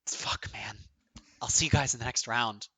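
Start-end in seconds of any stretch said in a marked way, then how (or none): 0.1-0.8 whisper. Real, not acted. Relieved after the win.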